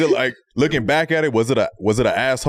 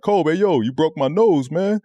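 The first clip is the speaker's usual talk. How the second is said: mock complaint; 7 semitones higher than usual. Roy confronting Kobe in story.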